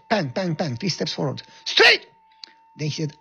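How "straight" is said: very high, loud command; drill shout. Retold or imitated. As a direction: snap the drill order.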